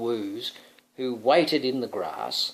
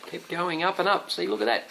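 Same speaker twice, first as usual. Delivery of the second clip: ordinary delivery, nothing marked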